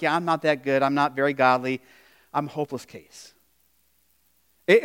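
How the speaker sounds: usual speaking voice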